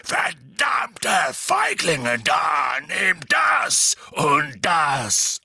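very slow, loud, furious bellow; enraged giant bellowing as he fights; roaring Hagrid. A narrator's reading. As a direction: roar each blow out with full force. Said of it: "brüllte Hagrid".

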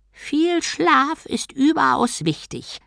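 very high, slow creature voice; turtle's voice. A narrator's reading. Turtle god lecturing on sleep, slow high voice. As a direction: draw it out as a high-voiced tortoise. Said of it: "sagte Om".

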